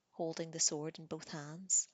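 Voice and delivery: ordinary delivery, nothing marked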